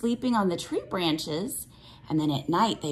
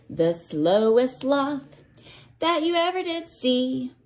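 put-on reading to children; 4 semitones higher, 3 dB louder than usual. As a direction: chant it like a picture book for little ones. Teacher reads cumulative rainforest book.

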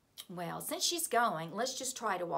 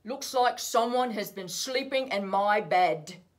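big, much louder Papa Bear; gruff great voice; 10 dB louder than usual. A narrator's reading. Teacher voicing Papa Bear to preschoolers, gruff and loud. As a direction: boom it out like a huge bear. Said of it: "in his gruff, great voice".

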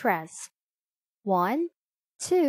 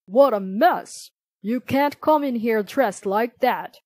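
louder, disapproving doorman in a story; mild scolding; 6 dB louder than usual. A narrator's reading. Man at the church door to messy Jillian, mildly scolding. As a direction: scold with a touch of outrage.

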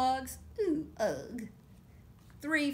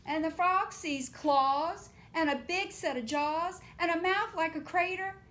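excited; 7 semitones higher, 6 dB louder than usual. A narrator's reading. Dramatic build to the gator.

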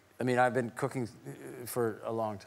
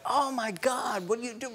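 put-on shocked kids; 13 semitones higher than usual. Kids coming home to a headless pig, mock shocked. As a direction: act out the horrified children.

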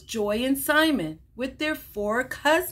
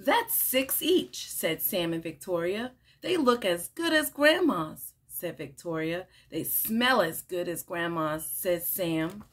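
animated picture-book read-aloud with lively character lines; reading to children; 4 semitones lower than usual. Acted. Teacher reading a picture book to children, bright and animated. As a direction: read it like story time for little kids.

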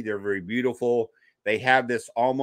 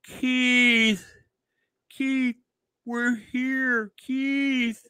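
high, drawn-out call imitated; distant calling; 12 semitones higher, much slower than usual. Parents calling to their unconscious son, high imitated calling. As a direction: call out a name high, without shouting. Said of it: "I could start to hear".

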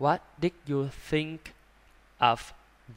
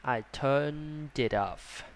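slow I turned it off, no puff on turned; slow model reading; much slower than usual. Shown as a demonstration. Teacher demonstrating a slow reading with no air on the d. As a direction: say it slowly with no air on the d. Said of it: "Không có gió ra".

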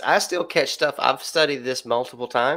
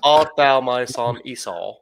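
second, louder try at an English accent; hammed-up English accent; 5 dB louder than usual. Shown as a demonstration. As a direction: project it in a put-on British accent. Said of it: "say that more in an English ac-, accent".

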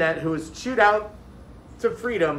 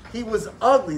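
plain reading voice

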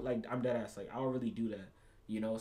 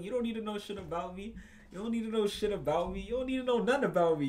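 indignant; 6 semitones higher, 4 dB louder than usual. Real, not acted. Repeating angrily, building.

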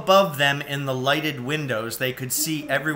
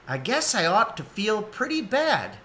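Ma's voice, higher, a touch rueful; Ma, lightly voiced; 8 semitones higher than usual. A narrator's reading. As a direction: voice Ma lightly, up in pitch. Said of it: "said Ma".

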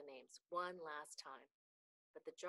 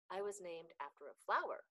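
plain reading voice

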